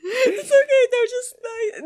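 laughing. Real, not acted. Laughing squeal.

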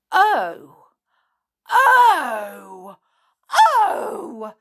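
loud, climbing high on the last; yelled oh's. Acted. Binkle jumping from bed, yelling. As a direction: yell as it dawns on you. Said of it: "yelled Binkle".

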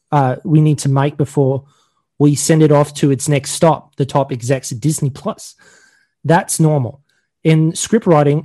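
put-on amateur Australian accent, measured announcement reading; mock Australian accent. Host reading a show update to cohosts, mock Australian accent. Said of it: "a really crappy version of an Australian".

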